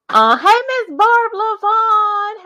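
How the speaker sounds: high, loud and slow, stretched out; sing-song greeting. Real, not acted. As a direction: croon her name across the room.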